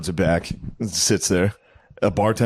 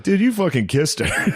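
laughing accusation; 10 semitones higher than usual. Retold or imitated.

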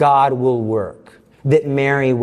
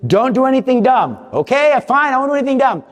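panicked thought; 10 semitones higher than usual. Retold or imitated. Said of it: "and I'm thinking".

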